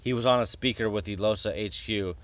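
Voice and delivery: ordinary delivery, nothing marked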